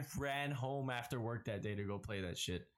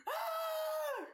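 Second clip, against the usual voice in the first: thrilled shriek; much slower than usual. Retold or imitated. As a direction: shriek it with joy. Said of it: "And I was like".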